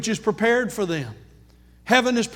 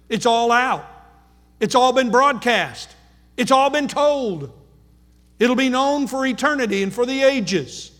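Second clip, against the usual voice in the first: building preaching; 4 semitones higher than usual. Acted. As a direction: build the sermon to a peak.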